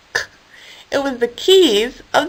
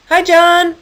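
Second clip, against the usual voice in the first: high, loud hi; calling out; 8 semitones higher, 3 dB louder than usual. Acted. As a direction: call across the yard. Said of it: "Harold called".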